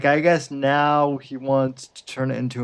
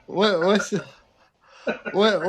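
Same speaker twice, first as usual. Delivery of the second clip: usual speaking voice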